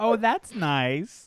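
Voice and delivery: slow, drawn-out Midwestern mimicry; teasing Midwestern imitation; Midwestern accent. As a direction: echo the Midwestern mom, stretched out. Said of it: "The Midwest in you is so strong".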